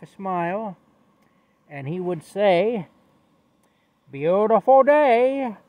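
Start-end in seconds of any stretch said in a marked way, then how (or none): 4.1-5.6 high, loud and drawn out slowly; cheerful hobo greeting. Retold or imitated. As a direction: beam it out to a passerby like the happiest man alive. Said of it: "that's how he sounded".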